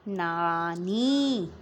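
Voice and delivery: नानी stretched slowly, both vowels held long; sounding out. Shown as a demonstration. Teacher blending letters for pupils, नानी sounded out slowly. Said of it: "join करना और sound निकालना".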